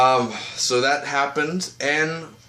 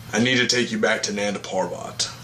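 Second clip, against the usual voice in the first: lightly put-on deeper voice; a slightly lowered, stern hero voice; Al Sahim; 3 semitones lower than usual. Oliver as Al Sahim confronting Nyssa, lightly deepened voice. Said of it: "he does more of a deeper voice".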